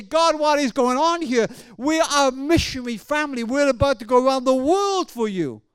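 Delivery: high, insistent plea to God; anguished protest. Retold or imitated. Wounded missionary questions God amid gunfire, anguished protest.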